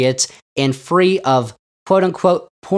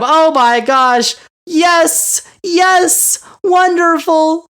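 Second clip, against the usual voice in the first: much higher, louder gushing approval; hammed-up fawning fan; mock cheering; 13 semitones higher, 4 dB louder, much slower than usual. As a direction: squeal agreement like an adoring crowd. Mocking Wallace's cheering crowd.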